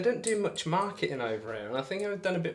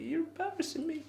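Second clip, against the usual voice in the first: very high put-on falsetto; playful whine, pitched way up; mock embarrassed squeal; 4 dB quieter than usual. Repeats mock complaint in squeaky voice.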